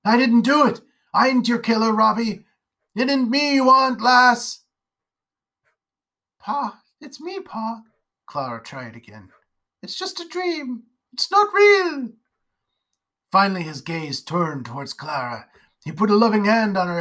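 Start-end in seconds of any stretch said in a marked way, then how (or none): 0.0-4.6 higher, loud frantic denial; pleading with a ghost from his past; desperate shouting. Acted. Father raving at a phantom. 6.4-7.8 put-on high, softer pleading child's voice; gently coaxing her father back; soft little girl. 9.8-12.1 insisting he is only dreaming; girl reassuring. Acted. Girl voice, soothing her father.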